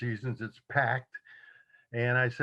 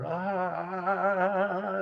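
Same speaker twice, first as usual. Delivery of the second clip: slow, high, stunned stammering gasps of a starstruck fan; going bonkers; 7 semitones higher than usual. Retold or imitated. Girlfriend meeting [name], starstruck high gasping. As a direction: imitate a starstruck girlfriend gasping, mildly hammed-up. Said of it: "she just went bonkers".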